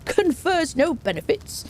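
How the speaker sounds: slow, high-pitched mock-divine proclamation; chortle, then declare it slowly and high; chortling god. Streamer retelling Crom's visit to the chat, chortling god voice. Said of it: "then he kind of chortled".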